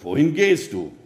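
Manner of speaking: lightly voiced calling wolf. A narrator's reading. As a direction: call the question over. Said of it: "rief der Wolf".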